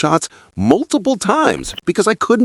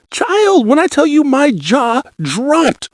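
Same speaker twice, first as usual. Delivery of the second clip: put-on "Child," much higher and quick falling; even higher shock; 10 semitones higher, slower than usual. Vlogger doubling her shock to viewers, very high "Child". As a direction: push the disbelief up further.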